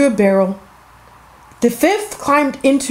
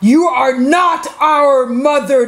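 high, drawn-out indignant cry; 4 semitones higher, 3 dB louder, slower than usual. A narrator's reading. Seven kids to the wolf at the door, crying out. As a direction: call it out slowly in protest. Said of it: "they cried".

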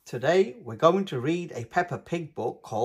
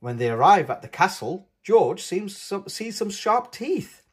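plain reading voice

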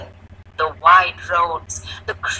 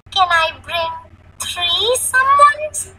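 put-on high-pitched, cheeky little girl; playful child; 10 semitones higher, 5 dB louder than usual. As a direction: stretch the end into a playful plea. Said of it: "asked Jaya playfully".

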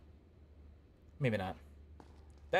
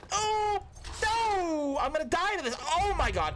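real panic; slower than usual. Real, not acted. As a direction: yelp as the trap closes in. About to die in game.

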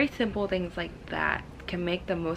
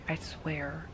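ordinary delivery

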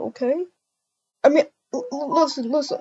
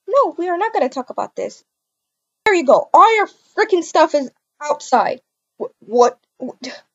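usual speaking voice

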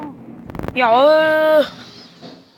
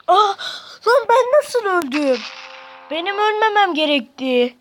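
higher, louder disbelieving yell; outraged shout; 9 semitones higher, 11 dB louder than usual. Real, not acted. After dying, yelling in disbelief to viewers. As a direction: scream at the screen in disbelief.

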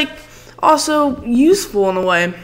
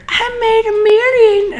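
mock PewDiePie; 13 semitones higher, 4 dB louder than usual.

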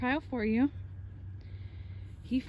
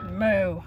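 imitation moo; 5 semitones lower, 4 dB louder than usual. Real, not acted. Mooing at the cows.

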